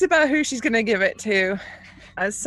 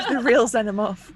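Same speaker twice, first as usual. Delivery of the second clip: laughing; 10 semitones higher than usual. Real, not acted. Laughing at joke.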